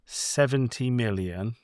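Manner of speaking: usual speaking voice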